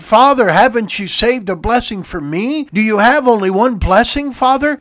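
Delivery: high, loud, anguished pleading cry; loud bitter cry. Acted. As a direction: cry out to your father, heartbroken. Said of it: "he cried out with a loud and bitter cry".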